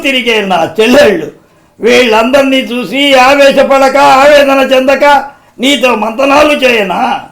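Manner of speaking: loud, high impassioned speech building to a roar; Krishna as Alluri Sitarama Raju. Acted. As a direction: thunder with anguish and defiance. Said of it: "Krishna గారు నటించారు climax సన్నివేశం".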